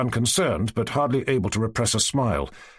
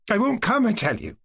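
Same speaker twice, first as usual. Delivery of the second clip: said aloud; 11 semitones higher than usual. A narrator's reading. Girl refusing the Cossack, suddenly aloud. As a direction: blurt it out, pulling away. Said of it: "Maryanka suddenly said aloud".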